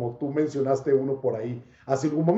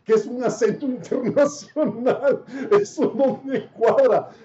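pregunta entre risas, incrédulo; riéndose incrédulo; 6 semitones higher than usual. Retold or imitated. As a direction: se ríe mientras cuestiona. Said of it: "y yo me río y digo". (pregunta riéndose, sin creérselo)

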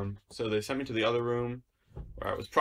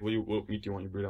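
very fast, softer counter question; rushed server question; 3 semitones lower, 5 dB quieter, much faster than usual. Retold or imitated. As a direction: rattle it off like a busy server. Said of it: "they're like".

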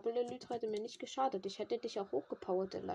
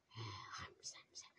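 whispered, much lower spell chant; whispered incantation; 13 semitones lower, 14 dB quieter than usual. Pretend. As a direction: breathe the summoning spell as a whisper. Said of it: "Beschwörung auf anderem Niveau". (geflüsterte Beschwörung)

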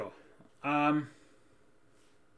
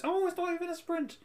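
high falsetto impression of his mum; squeaky high-pitched mum voice; man doing a woman's voice; 7 dB louder than usual. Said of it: "as Mum says".

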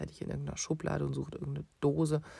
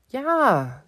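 put-on higher, louder defiant child's yes; child insists on the apple; stubborn yes; 9 semitones higher, 14 dB louder than usual. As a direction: blurt it out defiantly. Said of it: "sagt Kaki".

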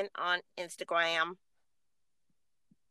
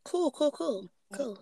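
put-on old man voice; 5 semitones higher, slower than usual. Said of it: "In my old man voice".

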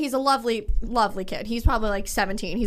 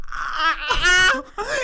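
high, loud flustered wail; panicked laughing cry; 7 semitones higher, 5 dB louder, much slower than usual. Retold or imitated. Caught being rude to the desk kid, flustered wail. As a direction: flounder out a mortified cry. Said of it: "And I was like".

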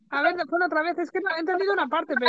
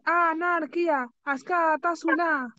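slow, carefully spelled-out phrase; slow teaching model; 3 semitones higher, much slower than usual. Shown as a demonstration. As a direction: say it slowly for the student. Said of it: "teaching the phrase". (lo dice despacio para que ella lo aprenda)

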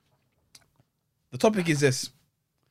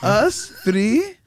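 loud, broken words with rising ends; caveman talk; 4 semitones higher, 5 dB louder, much slower than usual. Pretend. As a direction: address him like a caveman, word by word. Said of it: "talk to him like a caveman".

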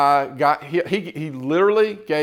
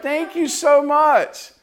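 high, slow, sweet thanks; lightly voiced grateful girl; imitating grateful girl; 11 semitones higher, 3 dB louder, much slower than usual. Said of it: "And she's like".

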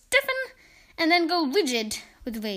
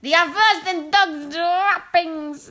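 slow, loud witch screech; 4 semitones higher, 5 dB louder, slower than usual. Acted. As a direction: spit the words out slowly, higher and louder. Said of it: "screeched the Grand High Witch".

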